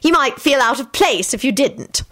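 high-pitched snippy remark; mildly peevish, pitched high. A narrator's reading. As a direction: concede it with pointed disdain. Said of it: "said snippily".

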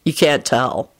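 low, bored remark; bored deadpan. A narrator's reading. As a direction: drop the pitch, uninterested. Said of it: "in his bored voice".

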